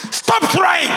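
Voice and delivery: angry coach after a slap; coach's angry shout. Retold or imitated. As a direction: shout it at the fighter. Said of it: "The man slapped me".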